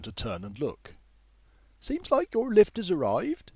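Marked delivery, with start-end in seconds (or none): 1.8-3.5 put-on high older woman's voice. A narrator's reading. Older woman telling the young man his lift is here, high. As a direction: announce his lift in her higher voice. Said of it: "she comments".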